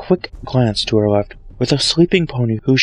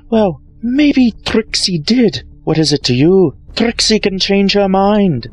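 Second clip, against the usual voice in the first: much higher, louder, slow defiance; higher defiant showmare voice; defiant mare; 9 semitones higher, 3 dB louder, slower than usual. Acted. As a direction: stand up to the spirit. Trixie defies Sombra.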